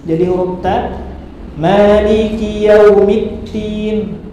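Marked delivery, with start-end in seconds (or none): 1.5-4.3 Maliki yaumiddin slow, ending in tin, not din; wrong t for d. Shown as a demonstration. Teacher showing the wrong t sound in yaumiddin. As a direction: recite it slowly with a t in place of d. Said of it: "dirubah menjadi at-tin".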